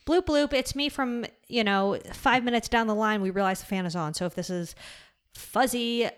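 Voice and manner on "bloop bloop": high playful "bloop bloop" noise; bloop sound effect. Pretend. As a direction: chirp it like a cartoon sound effect. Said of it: "maybe I'll put a little bloop bloop".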